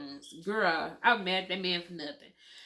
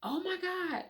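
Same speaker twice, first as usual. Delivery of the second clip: stunned reaction; 12 semitones higher than usual. Retold or imitated. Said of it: "I'm sitting up there like".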